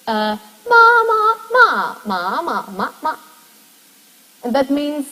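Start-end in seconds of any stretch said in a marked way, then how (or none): 0.7-3.2 each ma sung with its own Mandarin tone, the first one high and loud. Shown as a demonstration. Lecturer showing students Mandarin tones, distinct melody on each ma. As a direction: glide through ma, ma, ma with clearly different tone contours. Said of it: "you can say".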